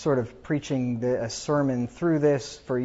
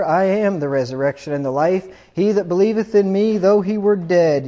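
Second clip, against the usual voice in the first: louder and higher preaching, swelling on "believeth"; preacher's climactic Bible reading; fervent scripture reading; 4 semitones higher, 6 dB louder than usual. Acted. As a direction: proclaim the resurrection promise with full force. Reads scripture, building to a climax.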